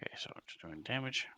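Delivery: quick whispered aside; whispering. Real, not acted. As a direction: whisper it under your breath. Player aside at table.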